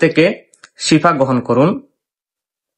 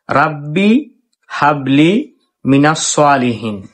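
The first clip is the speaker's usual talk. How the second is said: slow, drawn-out Arabic verse recitation; slower than usual. Shown as a demonstration. Teacher reciting a verse for viewers to repeat, slow recitation. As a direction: recite slowly so listeners can follow along. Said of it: "আমার সঙ্গে পড়তে পারেন".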